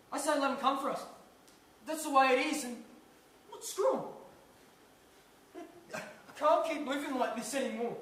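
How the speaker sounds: fed-up outburst. Acted. Husband telling his wife he is sick of being scared, loud and fed up. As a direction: let the fear spill out as anger.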